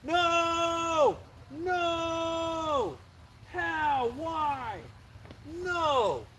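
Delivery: yelling at the lost fish in disbelief; real shout. Real, not acted. Angler losing his catfish shouts in real dismay. Fish just came off the hook.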